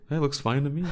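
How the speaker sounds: flat, quiet deadpan delivery. Retold or imitated. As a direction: say it with zero enthusiasm. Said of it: "In his deadpan voice".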